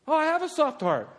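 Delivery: high, swooping mock whimper; mock self-pity. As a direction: put on a high, precious voice. Mocking self-pitying reply.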